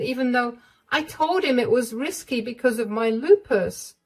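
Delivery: put-on distressed young wife. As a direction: confess it fearfully.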